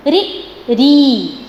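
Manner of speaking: री short, then री drawn out very slowly; short then long ri. Shown as a demonstration. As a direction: contrast the two री sounds by length. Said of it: "comparison कीजिए".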